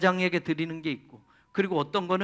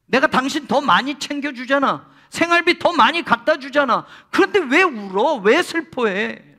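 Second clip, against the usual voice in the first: louder, higher pleading husband; puzzled husband; 6 semitones higher, 8 dB louder than usual. Retold or imitated. Husband baffled by his crying wife, loud coaxing. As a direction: coax her, baffled by her tears. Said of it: "라고 말하는 거예요".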